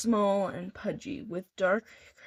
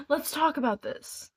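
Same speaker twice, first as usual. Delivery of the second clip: nervous pleading; 7 semitones higher than usual. A narrator's reading. Thug pleading, scared.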